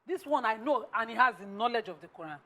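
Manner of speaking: ordinary talk, nothing marked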